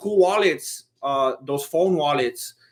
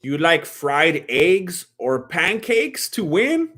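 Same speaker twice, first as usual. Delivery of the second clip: usual speaking voice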